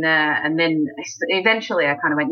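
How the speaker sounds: usual speaking voice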